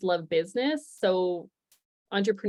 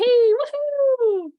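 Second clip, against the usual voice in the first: high, loud, drawn-out woohoo; celebratory whoop; 9 dB louder than usual. Real, not acted. Presenter cheering her small Zoom success, celebratory whoop. Celebrating getting screen sharing to work.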